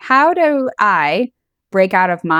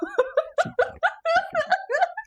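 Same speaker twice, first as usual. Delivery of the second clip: high, squealing laugh; shrieking laugh; 6 dB quieter than usual. Real, not acted. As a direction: burst into a high, helpless laugh. Reacting to the snail-trail line.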